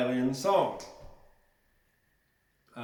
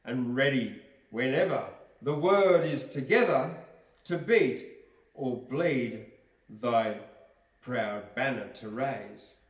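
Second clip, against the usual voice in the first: poem recitation; 3 semitones higher, 8 dB louder than usual. Acted. Reads a patriotic poem aloud.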